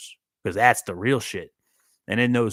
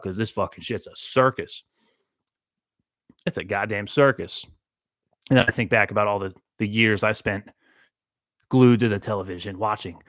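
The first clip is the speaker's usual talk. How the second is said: ordinary talk, nothing marked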